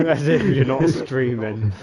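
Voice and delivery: loud laughing through the words. Real, not acted. Laughs at own joke.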